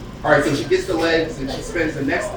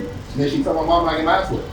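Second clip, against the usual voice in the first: usual speaking voice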